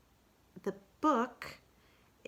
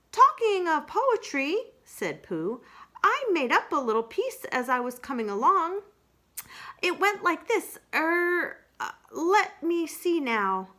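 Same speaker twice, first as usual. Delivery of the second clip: Pooh voice; 10 semitones higher, 7 dB louder than usual. Acted. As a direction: muse aloud, drawing it out.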